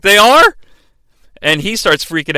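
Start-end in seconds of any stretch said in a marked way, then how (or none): 0.0-0.6 high, loud panicked question; startled Glenn. Retold or imitated. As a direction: blurt it out in alarm. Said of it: "He's like".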